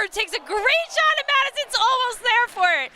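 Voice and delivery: high, rushing thrill of a near goal; excited play-by-play. Real, not acted. Commentator calling a great shot at Madison, excited. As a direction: call it with soaring excitement.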